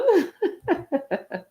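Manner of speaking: real, high chuckle in a quick run; amused laugh. Real, not acted. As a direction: laugh lightly at an interesting answer. Amused by a student's answer.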